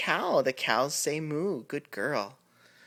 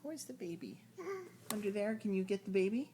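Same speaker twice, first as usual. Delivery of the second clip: higher, soft coaxing sing-song; 7 semitones higher, 7 dB quieter, faster than usual. Real, not acted. Parent coaxing toddler to fetch doll, high soft baby talk.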